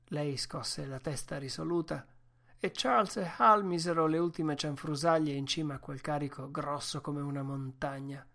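usual speaking voice

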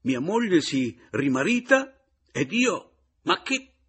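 high, very loud indignation; shouted, incredulous outburst; outraged protest. Acted. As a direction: protest at full voice, climbing in disbelief. Outraged at wife's remarriage.

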